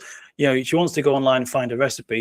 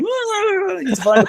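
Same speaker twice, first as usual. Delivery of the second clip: mock panicked calm; much slower than usual. Pretending calm while panicking.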